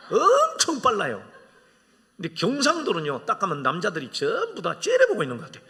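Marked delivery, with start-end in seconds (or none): 0.1-1.3 laughing emphasis. Real, not acted.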